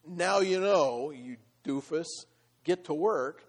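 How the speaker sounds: mock God scolding Elijah; mock thundering. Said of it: "God thundering down and saying".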